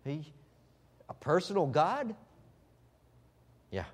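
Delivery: usual speaking voice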